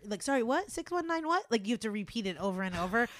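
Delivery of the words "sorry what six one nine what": confused. Retold or imitated. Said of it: "make someone repeat your".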